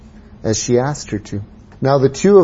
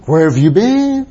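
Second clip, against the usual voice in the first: mock scolding; 8 semitones higher, 3 dB louder than usual. No need to ask them.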